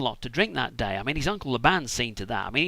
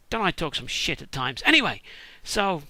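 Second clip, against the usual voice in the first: normal talking voice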